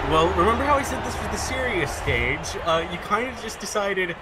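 high disbelieving voice; amazed, half laughing; incredulous. Real, not acted. Incredulous at opponent's move.

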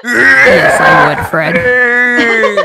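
loud, laughing yes; laughing excitedly. Real, not acted. Eager reply, laughing.

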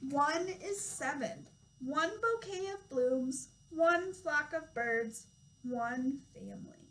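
slow, lilting read-aloud with each number drawn out; reading to children. Acted. Toddler teacher reading a counting book to children, slow storytime voice. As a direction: read it slowly and clearly for little ones.

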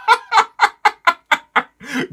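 high-pitched staccato villainess cackle; loud, high ha-ha-ha in bursts; acted haughty cackle; high mocking laugh. Manga character's mocking laugh.

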